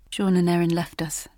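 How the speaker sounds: ordinary delivery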